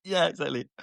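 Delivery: ordinary talk, nothing marked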